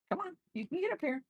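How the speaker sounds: sing-song coax to the dog; squeaky come-here voice for a pet; high pet voice. Real, not acted. Coaxing the dog up.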